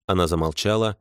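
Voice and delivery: ordinary delivery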